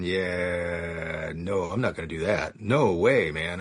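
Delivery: low, drawn-out dismissive drawl; skeptical refusal. Retold or imitated. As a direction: sink the pitch and stretch the doubtful first word. Skeptical inner refusal of the suggestion.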